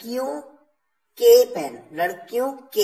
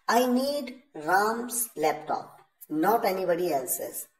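each word spaced out very slowly; slow dictation; much slower than usual. Shown as a demonstration. English teacher reading the example very slowly. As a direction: read it out slowly for the class. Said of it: "यानी".

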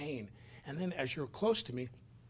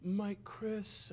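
sobbing; 9 semitones higher, 4 dB quieter, much slower than usual. Retold or imitated. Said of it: "convulsing, going".

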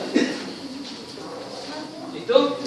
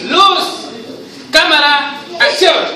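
director's call; 6 semitones higher, slower than usual. Acted. Director calls start of scene.

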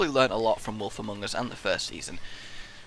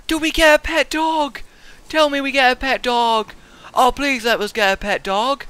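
high pleading, pitched way up; excited begging; 11 semitones higher, 7 dB louder than usual. Real, not acted. Gamer pleading with the game for a pet dog, excited. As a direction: beg eagerly for the dog.

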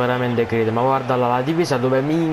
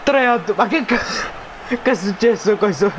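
laughing; 6 semitones higher than usual. Real, not acted. Ride dopo il gol.

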